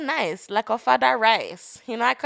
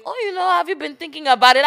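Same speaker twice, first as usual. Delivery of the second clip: mildly put-on higher, quick questioner; imagined listener asking; 7 semitones higher, faster than usual. Imagined listener wondering about her plans, lightly mimicked, quick.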